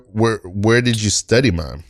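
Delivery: normal talking voice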